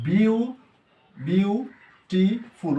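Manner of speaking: Beautiful spelled out slowly, syllable by syllable; teacher counting syllables for the class. Shown as a demonstration. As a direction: break beautiful into its three beats, slowly. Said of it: "উচ্চারণটা কবে হচ্ছে? তিনবার হচ্ছে।".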